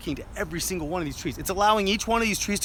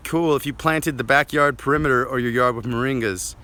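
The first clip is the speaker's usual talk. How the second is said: normal talking voice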